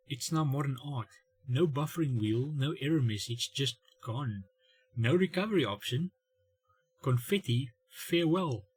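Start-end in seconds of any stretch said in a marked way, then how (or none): none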